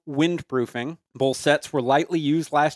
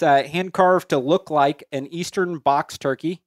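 usual speaking voice

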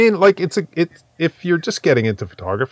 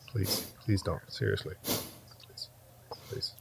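pleading, dropping to near whisper; whispered please fading away; 4 semitones lower, 14 dB quieter, slower than usual. Real, not acted. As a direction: beg softly, trailing off into a breath. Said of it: "begging cohost to stop chewing".

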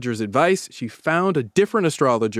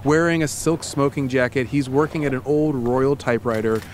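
lightly voiced guided-scene narration, calm and even; story narration; 5 semitones lower than usual. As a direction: paint the scene for her, unhurried. Narrates immersive scene to co-host.

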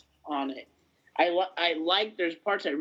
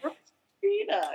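much higher, slow, savoring the name; drawn-out name; 13 semitones higher, slower than usual. Pretend. Host relishing the villain's name, drawn out high and slow.

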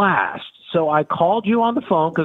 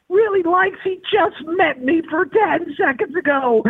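sharply higher, rising disbelief; really likes, said in stunned disbelief; shocked rookie echoing the line back in disbelief; incredulous; 13 semitones higher than usual. Retold or imitated. Disbelief at the manager's insult.

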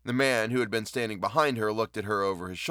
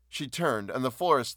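ordinary delivery

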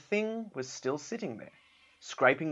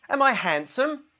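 high, louder creature call; bunyip calling; 12 semitones higher, 7 dB louder than usual. Acted. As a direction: call out hopefully, much higher. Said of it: "called the Bunyip".

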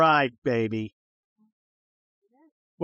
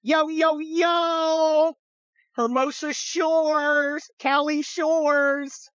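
high, shouted beach-bro hype; playing a reality-show bro, hyped shouting; party bro; 10 semitones higher, much slower than usual. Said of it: "I'm the scenario".

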